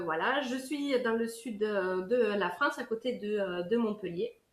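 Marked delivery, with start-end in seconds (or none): none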